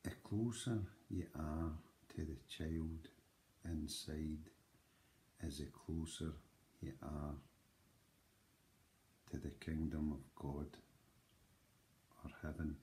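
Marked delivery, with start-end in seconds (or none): none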